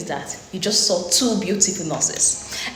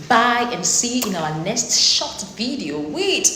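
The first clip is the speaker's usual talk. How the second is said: laughing; slower than usual. Real, not acted. Laughing sign-off to co-host.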